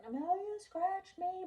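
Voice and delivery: put-on high, slow whiny little-girl complaint; mimicking a crying daughter telling on her sister; whiny child. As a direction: imitate a tattling kid who's upset. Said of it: "here comes Gianna crying again".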